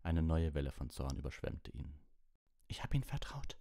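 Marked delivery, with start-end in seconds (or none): 2.7-3.6 high, strained outcry; voice out of control. A narrator's reading. Harry shouting betrayal at Lupin, voice uncontrolled. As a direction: cry it out, losing hold of your voice. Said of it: "seiner Stimme nicht mehr Herr".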